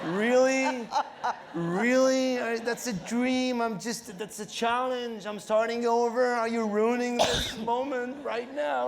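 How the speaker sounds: high, loud mock outrage, half laughing; exasperated disbelief. Comedian answering a French heckler with high, loud mock outrage. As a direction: play the wounded comic, incredulous and loud. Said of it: "I'm like".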